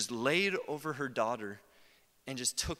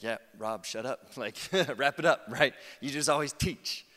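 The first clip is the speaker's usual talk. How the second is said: laughing mock retort; 7 dB louder than usual. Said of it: "And you're like".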